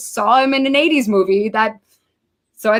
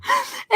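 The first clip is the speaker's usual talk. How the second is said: high squeaky giggle; 5 dB quieter than usual. Real, not acted. Laughing at the memory.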